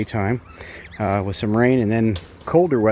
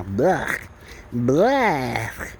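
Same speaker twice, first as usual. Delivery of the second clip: playful blech; mock yuck sound; mock disgust noise. Real, not acted. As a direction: pretend gag at the offer. Refusing bird's regurgitated food.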